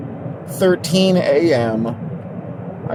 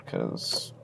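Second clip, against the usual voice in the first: smug little whisper; 20 dB quieter than usual. Acted. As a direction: whisper the brag aside.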